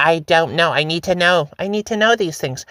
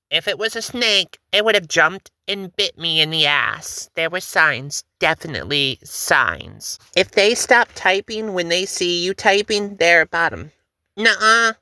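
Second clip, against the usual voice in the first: ordinary talk, nothing marked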